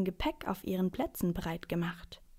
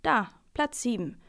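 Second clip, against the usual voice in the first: firm, raised and emphatic; pointed insistence; 4 semitones higher than usual. A narrator's reading. Man showing the children his seat number, insistent. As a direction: point it out as proof.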